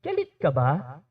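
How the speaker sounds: low, soft question; calm simple question. Shown as a demonstration. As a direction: ask it gently, no shouting. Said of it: "Sabihin mo lang yung simpleng".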